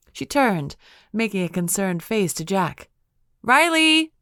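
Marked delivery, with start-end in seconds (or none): 3.4-4.1 high, loud call for the dog. A narrator's reading. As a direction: call the lost dog across the room. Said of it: "She called again".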